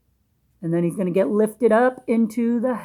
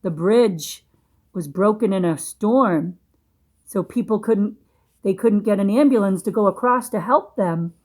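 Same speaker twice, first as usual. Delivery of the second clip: plain reading voice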